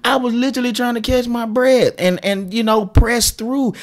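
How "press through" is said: ordinary talk, nothing marked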